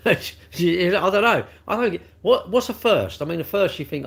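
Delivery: high-pitched, baffled questioning; amused bafflement. Real, not acted. Baffled by degree grades, laughing.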